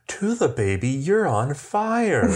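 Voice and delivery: mock cooing to the dog, stretched very slowly. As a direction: coo it sweetly, drawing out the last words. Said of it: "cooing, going".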